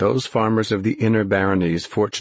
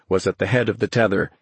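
ordinary delivery, nothing marked

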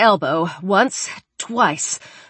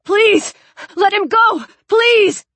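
high, loud, desperate cries; wild, high-pitched pleading; frantic begging; 13 semitones higher, 6 dB louder, slower than usual. Acted. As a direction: beg wildly for his release. Begging wildly for release.

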